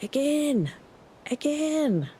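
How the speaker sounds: high-pitched mimicked cannoneer's cry in a thick backcountry accent; imitated accented shout. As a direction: call it out like the herder with the thick accent. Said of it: "Had this accent ... he'd shout".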